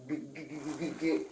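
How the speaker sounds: high-pitched stuttered G sounds, imitating him; imitated stutter. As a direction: mimic him stammering out the name. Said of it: "He starts stuttering my name".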